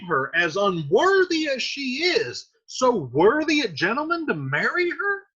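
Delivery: high-pitched, astonished questioning; incredulous. Acted. Teacher voicing Capulet hearing that she refuses, incredulous. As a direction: swoop up on each question in mock disbelief.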